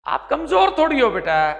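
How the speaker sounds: usual speaking voice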